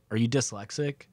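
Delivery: low, deadpan question from a blunt driver; real deadpan. Retold or imitated. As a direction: deliver it completely straight-faced. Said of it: "like, real deadpan".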